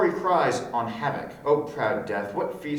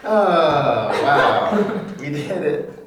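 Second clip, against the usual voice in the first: loud laughing joy; cheer, we finished; excited celebrating; 4 semitones higher, 9 dB louder, much slower than usual. Real, not acted. Finishing the read-through.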